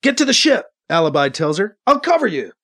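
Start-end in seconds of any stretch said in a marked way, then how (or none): 0.0-0.7 higher-pitched, pressing command; urgent order. A narrator's reading. Alibi orders wounded Katie to the ship, urgently. 1.9-2.5 higher-pitched, pressing assurance in battle; urgent call. A narrator's reading. Alibi covers wounded Katie's retreat, urgently. Urgent promise during firefight.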